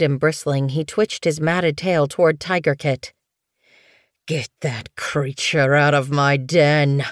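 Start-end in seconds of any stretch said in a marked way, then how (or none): 4.3-7.0 put-on slow, low-pitched snarling old cat. A narrator's reading. Old medicine cat snarls at the kit, slow and menacing. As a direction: drag out the threat with a snarl. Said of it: "he snarled".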